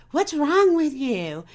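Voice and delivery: high, slow, exasperated mock demand; mock exasperated family. Family members confronting a client who cries, exasperated. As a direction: throw it at her like a baffled, impatient relative.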